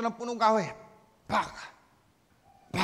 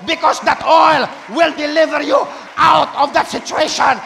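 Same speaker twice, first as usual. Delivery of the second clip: booming proclaimed line; preaching shout; 2 semitones higher, 10 dB louder than usual. Real, not acted. Pastor repeating the deliverance promise, booming. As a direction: hammer it home again.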